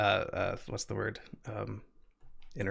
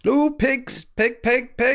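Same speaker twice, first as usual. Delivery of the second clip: high, chanted pig call; pig-calling dialect; 12 semitones higher, 4 dB louder, slower than usual. Acted. Imitating the local man calling pigs, high dialect call. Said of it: "that's what he calls pigs".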